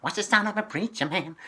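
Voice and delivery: imitating a shaky nasal singer. Shown as a demonstration. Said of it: "what her voice is like".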